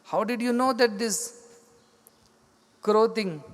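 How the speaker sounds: retold shock, breaking off in disbelief. Saint asks how the woman knew about the crow, shocked. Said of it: "And he's shocked".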